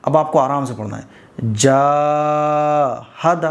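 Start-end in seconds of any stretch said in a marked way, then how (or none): 1.5-3.4 long drawn vowel on جاہد; slow stretched. Shown as a demonstration. Teacher showing how to stretch the letter. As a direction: stretch the jeem slowly. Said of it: "ایسے".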